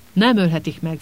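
ordinary delivery

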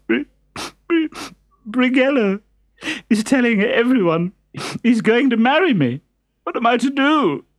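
high, tearful pleading voice; tearful girl. Acted. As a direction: sob out the worry. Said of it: "crying as if her heart would break".

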